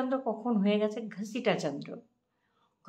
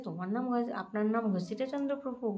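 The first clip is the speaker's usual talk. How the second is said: surprised question; 5 semitones higher, 4 dB louder than usual. Retold or imitated. Lakshmi surprised by the name.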